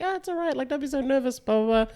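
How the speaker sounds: light high soothing woman's voice, lightly voiced; a hint of Sharon cooing to calm him; imitating Sharon. Sharon calming nervous guy.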